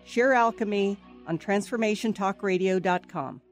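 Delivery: ordinary delivery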